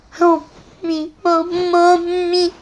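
ordinary delivery

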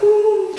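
high squeaky moved ooh; choked-up ooh. Real, not acted. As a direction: let the emotion crack out. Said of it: "And of course, I'm like".

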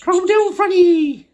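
high, loud, slow call upward; shouted call. Acted. Joe calls up the Helter Skelter, shouting high. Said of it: "shouted Joe".

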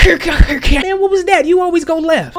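high-pitched squawk of complaint; exasperated hero. Acted. Young hero scolds partner during fight, exasperated.

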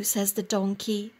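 ordinary delivery

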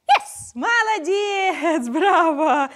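high, loud gushing praise; excited praise. Real, not acted. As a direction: cheer the pup on. Praising dog after success.

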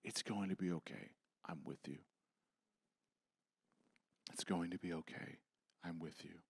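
hushed voice of Jesus, tender; tender reassurance. Acted. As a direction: murmur it like soothing a frightened child.

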